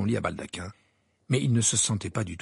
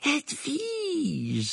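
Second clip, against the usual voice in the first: delighted cry. A narrator's reading. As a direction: call out to a long-awaited pet. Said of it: "s'écria-t-il". (s'écrier, voix haute)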